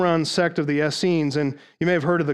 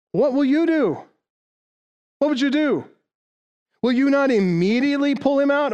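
urgent rhetorical plea; 8 semitones higher than usual. Acted. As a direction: pitch jumps high, pleading what will you do. Preacher pressing rhetorical question.

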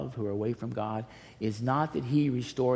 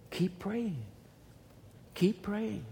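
high urging; 8 semitones higher, 5 dB quieter, slower than usual. Real, not acted. Urging the congregation.

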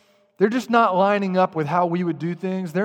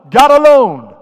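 GOD ALONE, both words punched much louder and higher; emphatic stress; 6 semitones higher, 13 dB louder, slower than usual. Real, not acted. Preaching on salvation, he hammers "God alone" loud and high. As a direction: ring out "God alone" as the peak of the point.

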